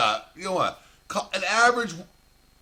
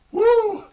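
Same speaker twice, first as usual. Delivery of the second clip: a high, loud "woo"; whooping yell; 6 dB louder than usual. Real, not acted. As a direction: let out a wild whoop. Exclaiming about the fight.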